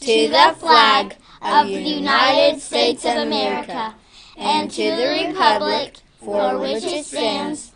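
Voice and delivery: school intercom. Real, not acted. Pledge over intercom.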